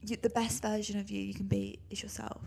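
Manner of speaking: mock-secret whisper. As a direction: breathe it like a secret. Playful whispered advice.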